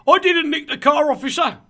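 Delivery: loud, high protesting thief caught by police; guilty car thief. Retold or imitated. Caught thief shouting to the officer. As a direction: yell your innocence at the officer. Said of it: "and the guy goes".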